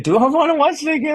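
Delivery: high, quick mock question; retold self-questioning. Friend recalling doubts about rewatching, high-pitched self-questioning. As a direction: ask yourself in a high, hurried voice. Acts out own reluctant reaction.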